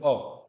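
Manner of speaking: "अ" as a short, quick vowel. Shown as a demonstration. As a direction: say "अ" briefly, no stretching. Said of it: "ये कैसा आपका ह्रस्व वर्ण है".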